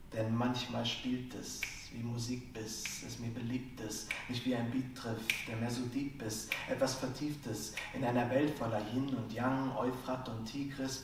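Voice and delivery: soft, rhythmic spoken word; hushed slam poetry. Acted. Slam poet likens poetry to music, hushed rhythmic delivery.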